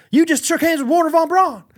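high excited movie line; excited quote. Retold or imitated. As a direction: say it in starstruck awe.

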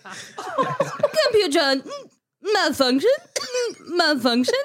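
slow, high computer voice with little hums; a glitching robot announcing itself; drawn-out machine voice, on the edge of laughing; Computron. Shown as a demonstration. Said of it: "just do one sentence of Computron".

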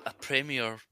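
ordinary talk

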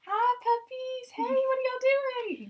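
put-on high, slow baby talk to puppies; doggy voice. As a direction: coo sweetly at the dogs like a baby. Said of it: "Giving 'em my best doggy voice".

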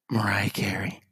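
breathy hushed whisper of the name; dramatic whisper. Acted. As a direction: whisper it like a reveal. Whispered name after song intro.

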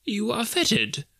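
high, slow, fearful voice; trembling fear. A narrator's reading. Scrooge seeing the ghost's chain, trembling fear. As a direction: speak up timidly to a phantom.

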